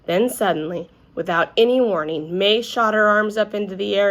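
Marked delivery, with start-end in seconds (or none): none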